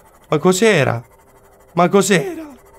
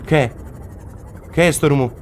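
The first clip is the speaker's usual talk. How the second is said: ordinary delivery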